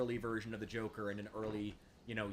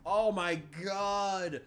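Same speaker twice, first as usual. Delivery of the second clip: disbelief; 7 semitones higher, 6 dB louder, much slower than usual. Real, not acted. Drawn out disbelief.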